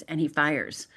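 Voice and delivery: ordinary talk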